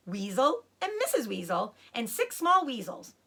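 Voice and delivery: usual speaking voice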